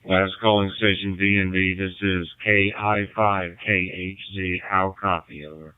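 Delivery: radio transmission. Real, not acted. Ham radio call heard on stream.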